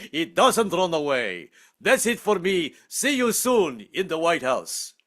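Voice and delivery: mock Russian accent as Vladimir; hammed-up Russian villain greeting. Comedian doing the vice president as Vladimir, in a Russian accent. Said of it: "This is my impression of our new vice president".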